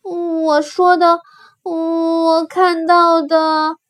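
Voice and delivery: high, very slow, hesitant answer; timid rabbit. Acted. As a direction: confess timidly, stretching each word. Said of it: "胆小兔低声胆怯地回答". (胆怯地拖长声音回答)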